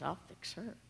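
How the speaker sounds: whispered plotting neighbour; a grumpy neighbour's whispered scheme. Acted. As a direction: hiss it like a sly plan. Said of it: "And he goes".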